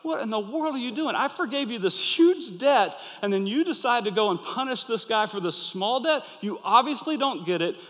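retold master scolding in fury; furious master. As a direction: tear into the ungrateful servant. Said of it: "the master was furious".

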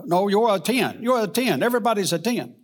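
high, loud imitated Jamaican preacher; Jamaican accent. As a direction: call it out in a lilting Jamaican accent. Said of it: "he would say in his Jamaican accent".

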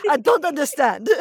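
high, loud, hammed-up French accent; mock French accent. Imitating French housemates confused by a drinking game, mock French accent. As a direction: play the baffled French housemate over the top. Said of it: "That wasn't even French".

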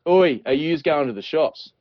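broad, slow northern Australian "oi"; tropical Australian accent. Shown as a demonstration. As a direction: call out in casual tropical Aussie dialect. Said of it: "where we might say".

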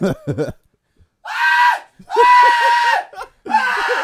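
re-enacted panicked screams; terrified screaming. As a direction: imitate screaming in panic at the rat. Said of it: "I was just like".

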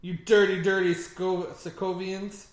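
mock villain voice. Mocking villain line.

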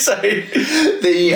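high, slow, still giggling; laughing restart. Real, not acted. Host tries to get back on track, still laughing. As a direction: try to start while laughing.

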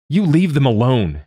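high, loud defiant shout. A narrator's reading. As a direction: shout them off with all the lungs you have. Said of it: "she shouts, in a voice bigger than her lungs".

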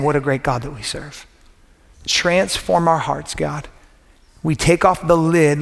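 hushed, earnest pastor's prayer. Acted. Pastor leading the church in quiet prayer. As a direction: pray over the congregation gently. Opening prayer before sermon.

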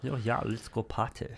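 soft camp German voice; camp German accent. Pretend. German guy suggesting a party, camp German accent.

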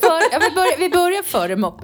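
high, loud, through laughter; laughing. Real, not acted. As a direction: answer while cracking up. Bursts out laughing while answering. (svarar genom skratt)

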